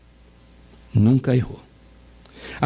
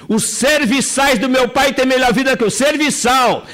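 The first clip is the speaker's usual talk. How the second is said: higher, slower, pleading son; a son thinking aloud in a higher, slower voice; prodigal son's inner voice; 10 semitones higher, slower than usual. Acted. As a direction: speak as the hungry son resolving to go home. Prodigal son talks to himself.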